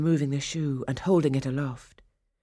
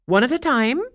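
higher, louder warning stepmother; 9 semitones higher, 8 dB louder than usual. Acted. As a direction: rein in the daughters with a sugary warning. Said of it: "their mother warned".

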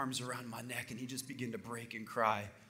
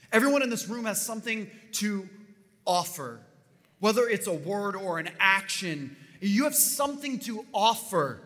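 rising, loud sermon cadence; preaching at full force; 6 semitones higher, 6 dB louder than usual. Acted. Preacher rallies the congregation, building to full-force preaching.

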